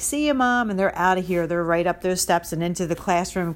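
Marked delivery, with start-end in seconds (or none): 0.0-0.7 put-on higher, breezy child's goodbye; child waving off mom at preschool, mock breezy; carefree kid. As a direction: toss off a cheerful kid's farewell. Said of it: "they're like".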